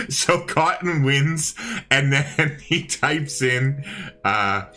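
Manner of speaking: fighting giggles while telling the story; laughing. Real, not acted. Laughing while retelling chat.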